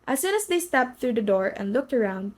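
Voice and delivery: ordinary delivery, nothing marked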